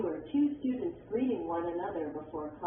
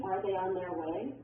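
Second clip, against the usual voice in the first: yes/no question rising on "way"; rising intonation. Shown as a demonstration. Textbook example of a yes/no question, rising intonation. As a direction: let the voice climb at the end on "way". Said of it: "arrows that show rising intonation".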